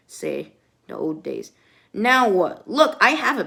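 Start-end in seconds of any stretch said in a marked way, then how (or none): 0.1-1.5 a hint of an old croak, much lower; lightly voiced old figure. Narrator dips into a lightly voiced old-timer. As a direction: drop low like an old-timer.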